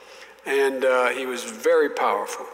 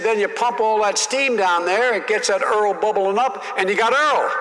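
higher, louder folksy senator lecturing; Russell Long explaining; 7 semitones higher, 4 dB louder than usual. Retold or imitated. Senator explaining stripper wells to a young colleague, high and loud. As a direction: talk it up high like an old Southern senator schooling a kid. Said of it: "And he said".

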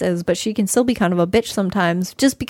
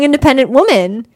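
ordinary delivery

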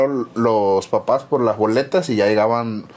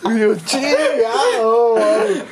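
laughing dismay; 9 semitones higher, slower than usual. Retold or imitated. Retold dread at mother's look.

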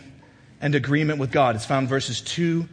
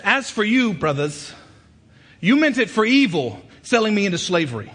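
raised, impassioned preacher's voice; full-force preaching; 9 semitones higher, 7 dB louder than usual. Acted. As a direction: drive each phrase home, high and loud.

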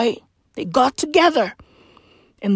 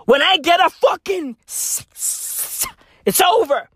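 shouted outburst; 7 semitones higher than usual. Real, not acted. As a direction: blurt it out in a stammering shout. Stuttering rant about getting snake.

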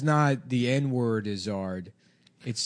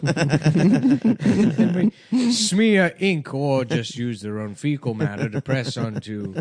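pompous Victorian gentleman; 3 semitones higher, slower than usual. Acted. Inventor presenting in 1800s.

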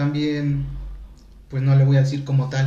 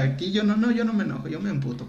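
high, mocking brush-off; half-joking crude comeback; lightly acted crude retort; crude blunt retort; 5 semitones higher than usual. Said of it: "le decía".

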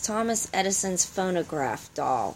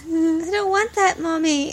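put-on high, slow little-kid plea; child refusing a toy; 8 semitones higher than usual. Child to Mommy about a creepy talking doll, high pleading.

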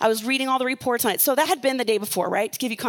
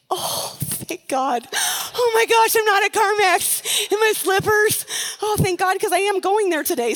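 relieved exclaim; 7 semitones higher than usual. Retold or imitated. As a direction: gush with relief on waking. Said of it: "And I was like".

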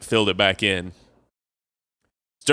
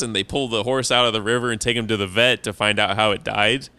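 ordinary talk, nothing marked

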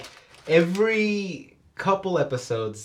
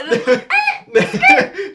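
high squeal of laughter; 7 dB louder than usual. Real, not acted. Laughing at a joke.

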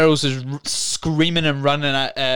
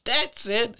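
much higher, squeaky goofy voice; hammed-up falsetto stoner; high cartoon voice; mock realization; 11 semitones higher, much slower than usual. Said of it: "And he's like".

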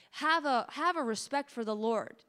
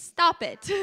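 quoting Pastor James; 4 semitones higher, 5 dB louder than usual. Retold or imitated. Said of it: "In the words of Pastor James".

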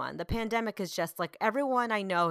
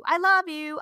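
high, louder teasing declaration of love; sing-song it back, pitched up; playful dad; 9 semitones higher, 7 dB louder, slower than usual. Retold or imitated. Mom voices husband answering their son, playfully loving. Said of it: "trying to be funny".

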